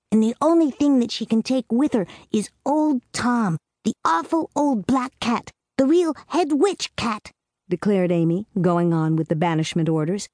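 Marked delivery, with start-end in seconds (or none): 0.1-7.3 put-on higher, earnest little girl voice; a small girl laying down the rules; little girl Amy. A narrator's reading. Amy telling Clarissa the witch's fate, in a higher girlish voice. Amy lists banishment orders.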